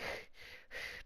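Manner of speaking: soft, voiceless sigh of a cornered fighter; helpless sigh. Acted. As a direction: sigh quietly, at a loss. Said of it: "Senna's like".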